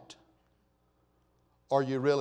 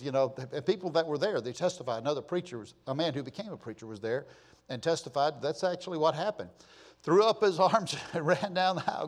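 ordinary delivery

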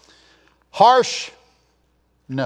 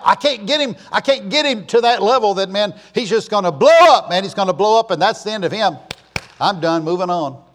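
mock Satan, louder and higher; Satan scheming; 5 semitones higher, 5 dB louder than usual. Preacher voicing Satan plotting a believer's blow-up, scheming. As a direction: voice the devil plotting a downfall.

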